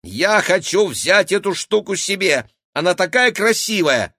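put-on high, loud childish wanting; eager girl voice. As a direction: gush over the pretty thing.

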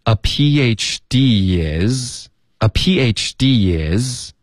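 "A P H D is" very slow, with a y glide between "D" and "is"; drawn-out "A P H D is", last two words joined; D linked to is. Shown as a demonstration. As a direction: slide "D" into "is" as dee-yiz. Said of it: "D跟is它连读了，添加了一个一".

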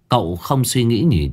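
ordinary delivery, nothing marked

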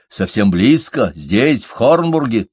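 insistent questioning. A narrator's reading. Строри presses his friends about the nearby power, insistent. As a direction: press for an answer, urgently. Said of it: "не унимался Строри".

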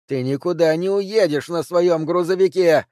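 high, loud shout. A narrator's reading. Woman shouts toward the forest at the hidden man. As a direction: yell it toward the trees. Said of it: "закричала Ханна в сторону леса".

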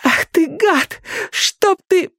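very high, slow, tearful curse; high-pitched venomous outburst; spiteful sobbing curse. A narrator's reading. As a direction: spit it out spitefully between sobs. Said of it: "Судорожный всхлип, злобный взгляд".

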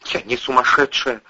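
choked, strained protest. Acted. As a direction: squeeze the words out through a tight throat. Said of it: "сдавленным голосом проговорила".

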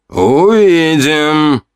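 drawn-out, high, musing word; pensive. A narrator's reading. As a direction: stretch it out slowly, pondering. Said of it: "задумчиво сказал шаман".

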